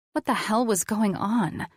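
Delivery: ordinary delivery, nothing marked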